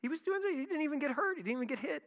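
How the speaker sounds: high-pitched, incredulous outburst; stunned disbelief. Retold or imitated. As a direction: sound like you can't believe the news. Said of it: "It's just like".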